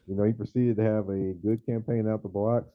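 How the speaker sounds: ordinary delivery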